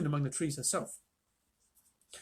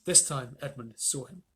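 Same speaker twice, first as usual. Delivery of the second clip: ordinary delivery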